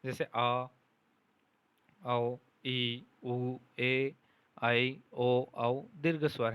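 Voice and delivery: आ, ई, ऊ, ए, ओ, औ each held long; long vowels demonstrated. Shown as a demonstration. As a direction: draw out every vowel आ औ ई उ ए for the class. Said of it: "दीर्घ स्वर हो जाता है".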